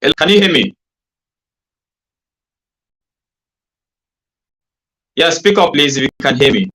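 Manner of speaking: loud, high calling out; to someone hard of hearing. Real, not acted. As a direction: raise the voice to be heard. Calling to a guest on a bad line.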